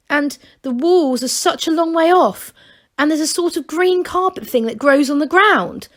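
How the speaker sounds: high, eager gnome voice; breathless wonder at the outside; excited young gnome. A narrator's reading. A young gnome tells Masklin about outside, high and eager.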